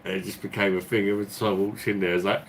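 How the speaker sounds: usual speaking voice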